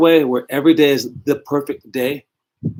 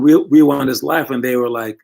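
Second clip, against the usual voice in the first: usual speaking voice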